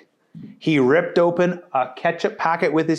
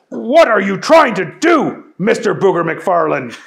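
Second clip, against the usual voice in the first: louder and higher angry demand; louder, higher host's shout; Chet yelling; 5 semitones higher, 7 dB louder than usual. Acted. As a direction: tell off the misbehaving contestant. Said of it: "yelled Chet".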